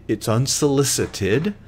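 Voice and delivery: ordinary talk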